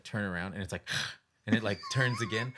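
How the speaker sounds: normal talking voice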